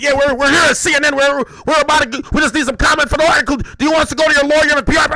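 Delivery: fast and loud, rattled-off reporter voice; fast pushy reporter. Retold or imitated. CNN reporter pressing for comment, fast and loud. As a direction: push him for a comment, quickly. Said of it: "the fucking reporter called me".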